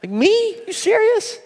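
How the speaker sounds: can't believe she chose you; stunned disbelief. Retold or imitated. Retelling his stunned disbelief.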